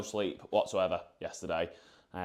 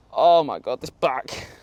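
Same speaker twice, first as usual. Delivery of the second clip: pained groan; 4 semitones higher, 10 dB louder, slower than usual. Real, not acted. Back pain reaction.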